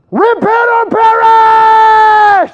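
very high, very slow bellow through cupped hands; street preacher shout. Retold or imitated. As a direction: boom it like a megaphone at passersby. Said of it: "exactly like that".

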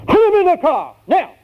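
loud, high-pitched yelled command; drill sergeant. Acted. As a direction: holler orders like a drill sergeant. Sergeant yells an order.

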